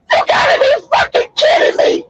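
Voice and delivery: high, laughing near-shout of disbelief; outraged disbelief. Retold or imitated. Father to his wife, a high laughing outburst of disbelief. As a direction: blow up at the absurdity, half laughing. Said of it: "you said".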